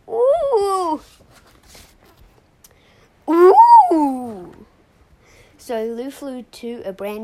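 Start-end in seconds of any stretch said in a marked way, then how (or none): 0.1-4.7 very loud high "ooh" wails; long hammed-up cries of urgency; desperate wail. A narrator's reading. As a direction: wail it out at full volume.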